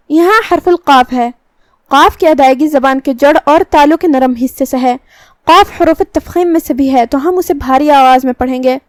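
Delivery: ordinary talk, nothing marked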